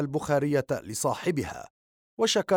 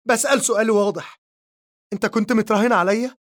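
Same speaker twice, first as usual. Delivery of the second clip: much higher, louder, fast cry with متراهن pushed up; screaming at him; 8 semitones higher, 4 dB louder, faster than usual. A narrator's reading. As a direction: demand the truth in a shriek. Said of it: "لتصرخ فيه نور".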